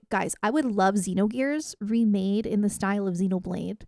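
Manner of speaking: ordinary talk, nothing marked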